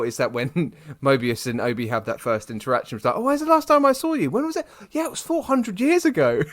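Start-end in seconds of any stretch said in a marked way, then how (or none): 3.1-4.7 high, mock cheery voice; playful high-pitched impression of a friend; imitating Mobius. Mimicking Mobius greeting Obi. 4.9-6.4 high, mock casual reply; light high-pitched impression; imitating Obi. Mimicking Obi's reply.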